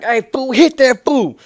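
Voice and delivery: yelling to his friend across the dirt; calling out. Retold or imitated. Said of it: "And I was like".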